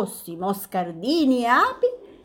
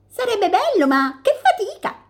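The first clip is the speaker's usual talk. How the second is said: much higher, chirpy bird voice; chirping robins; 13 semitones higher, 4 dB louder than usual. Acted. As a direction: twitter it like little robins. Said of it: "cinguettarono i pettirossi".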